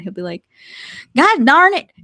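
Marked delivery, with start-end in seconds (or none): 1.2-1.9 imitating a mad Southern outburst, high and loud; mock-angry cry, pitched up; really mad. Imitating partner upset at video games, mock-angry outburst. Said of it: "when he's really mad".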